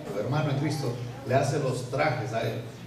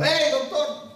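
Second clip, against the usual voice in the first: calling out; 10 semitones higher, slower than usual. Retold or imitated. Pastor calling after leaving judge.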